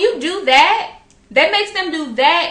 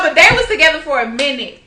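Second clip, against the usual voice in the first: ordinary talk, nothing marked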